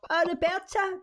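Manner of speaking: put-on high elderly lady voice, checking the name; old pensioner voice. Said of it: "pensioners on the phone going".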